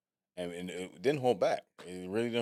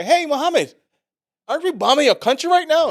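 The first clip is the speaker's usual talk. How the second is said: high, loud, hailing call; 11 dB louder than usual. Retold or imitated.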